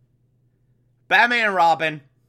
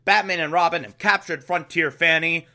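ordinary talk, nothing marked

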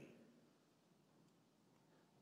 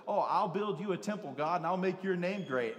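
eager David; 6 semitones higher, 7 dB louder than usual. Retold or imitated. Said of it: "David's like".

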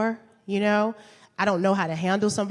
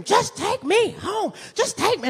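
mock scream; 12 semitones higher, slower than usual. Boyfriend screaming quoted.

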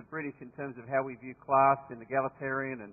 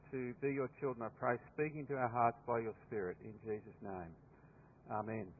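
prayer; 5 semitones lower, 8 dB quieter than usual. Acted. Leads congregation in prayer.

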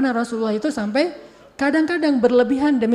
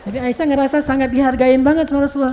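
ordinary talk

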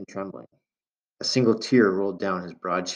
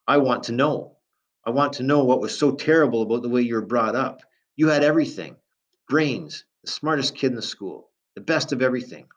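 louder, higher angry tirade building up; rising anger; 5 semitones higher, 5 dB louder than usual. A narrator's reading. Father confronting runaway son, angry and loud. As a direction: pour out the grievance, climbing. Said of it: "His voice was rising".

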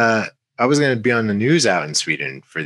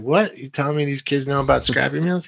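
retold surprise; 7 semitones higher than usual. Surprised kids know his brand.

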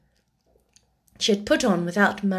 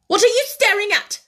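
fast, high, angry snap; sharp demand; 13 semitones higher, 7 dB louder, faster than usual. A narrator's reading. Headmistress challenges the staring child, sharply. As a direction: snap it out loudly. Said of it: "demanded Miss Minchin sharply".

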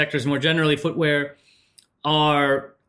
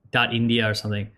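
ordinary talk

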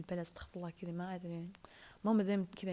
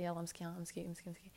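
soft cooing repeated مسكين, fading softer; 7 dB quieter than usual. Acted. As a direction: coo over her like a pet. Cooing over her sister.

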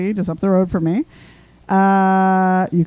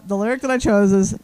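normal talking voice

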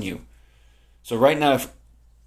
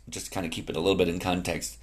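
ordinary talk, nothing marked

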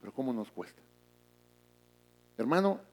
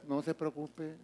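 calm, softer "No se preocupe"; soft reassurance; 10 dB quieter, slower than usual. Retold or imitated. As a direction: soothe the worried member gently. Pastor answers calmly. (pastor que tranquiliza con suavidad)

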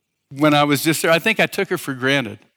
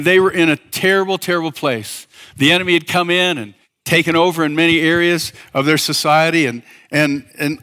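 ordinary delivery

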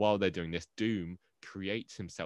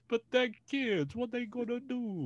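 bad Italian accent. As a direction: play a cartoonish Italian scriptwriter, much higher. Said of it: "We gotta do twenty seconds of bad Italian".

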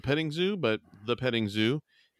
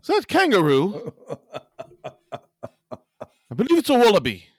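higher, louder mock Scottish accent; Scottish drunk; 7 semitones higher, 6 dB louder than usual. Scotsman leaving a pub spots a wallaby, in a Scottish accent. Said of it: "you're a Scotsman coming out of a bar".

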